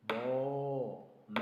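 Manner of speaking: loud moo then bleat; cow and goat sounds. Shown as a demonstration. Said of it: "sound of a goat".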